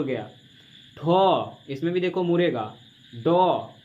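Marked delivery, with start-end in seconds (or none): 1.0-1.6 ठो louder, with the tongue curled back; showing the retroflex sound; tongue-curl demo. Shown as a demonstration. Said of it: "आप खुद से बोल के देखो". 3.1-3.7 डो with the tongue curled back and lower; showing the retroflex sound; tongue-curl demo. Shown as a demonstration. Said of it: "आप खुद से बोल के देखो".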